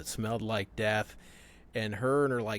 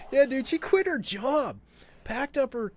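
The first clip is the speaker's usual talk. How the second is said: laughing; 13 semitones higher than usual. Real, not acted. Laughing at the absurd story.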